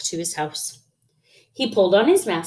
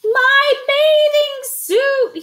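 very high, slow, drawn-out shout; 6 dB louder, much slower than usual. A narrator's reading. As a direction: wail each word loudly in alarm. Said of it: "he shouted".

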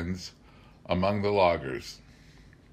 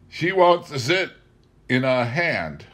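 Tom voiced higher and louder despite the mild tag; mildly; 6 semitones higher, 5 dB louder than usual. Tom telling the banker she wants in, said mildly. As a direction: state it easily to the banker. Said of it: "said mildly to the banker".

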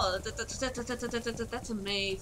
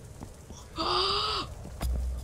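sharp gasp; 9 semitones higher than usual. Real, not acted. Relief after escape.

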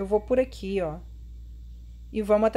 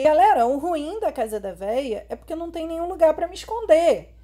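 ordinary talk, nothing marked

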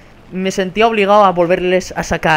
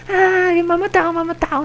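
mock whining; 10 semitones higher than usual. Streamer mocking players' complaints about being killed, mock whining. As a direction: imitate a complaining player in a high whine. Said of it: "que es dejar de decir, mm, paridas".

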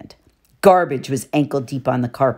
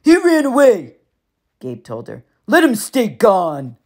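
higher, slow dismissive bully; cold Gabe; 5 semitones higher, 4 dB louder than usual. A narrator's reading. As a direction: shut her down flatly. Gabe voiced in narration.

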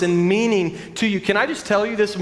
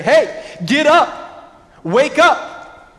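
louder, higher wake-up call; in-your-face call; 8 semitones higher, 5 dB louder, much slower than usual. Retold or imitated. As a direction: get in their face and rouse them. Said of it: "get in your face and say".

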